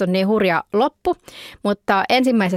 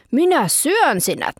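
higher, slow growling bear threat; angry bear; 7 semitones higher, slower than usual. Acted. Angry bear threatening the man in the sauna, slow mock growl. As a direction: threaten to eat him, slowly. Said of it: "kiukkusen näköinen karhu, hampaat irvessä".

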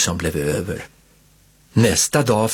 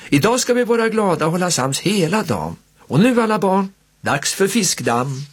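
lightly voiced cheery aunt, higher, rallying the kids; cheerful aunt; 8 semitones higher than usual. A narrator's reading. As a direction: gather the children kindly. Aunt leads party games.

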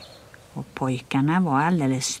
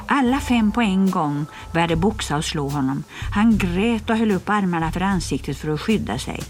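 ordinary delivery

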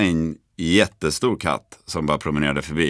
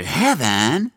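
much higher, rising, crazed-eyed announcement; wide-eyed proclamation; 8 semitones higher than usual. Retold or imitated. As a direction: declare it pressed to the glass. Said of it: "och säger". (mycket högt, stigande och galet utropat)

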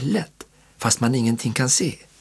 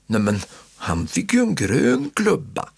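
mild growl; 7 semitones higher than usual. A narrator's reading. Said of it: "morrar Martin".

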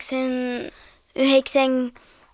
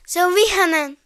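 mock scared child; 5 semitones higher, 5 dB louder, faster than usual. Child frightened of angry janitor.

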